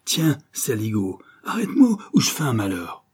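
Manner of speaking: angry yelling. A narrator's reading. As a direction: rail at a hated boss. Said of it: "hurla-t-il". (colère jouée, voix qui monte)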